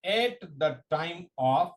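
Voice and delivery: word-by-word dictation with long pauses; slow dictation. Real, not acted. Teacher dictating a definition to exam students, slow dictation. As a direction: dictate slowly, leaving time to write.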